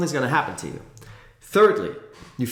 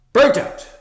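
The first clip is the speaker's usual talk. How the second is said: Burnt out much higher and louder; emphatic repeat; 12 semitones higher, 9 dB louder than usual. Shown as a demonstration.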